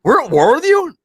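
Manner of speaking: the villager stunned to hear of war; mock shock. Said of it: "He's like".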